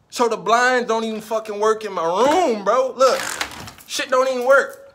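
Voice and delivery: loud, high, fed-up complaining; venting at full volume about the broken blinds; exasperated rant. Real, not acted. To viewers about his apartment, loud exasperated ranting.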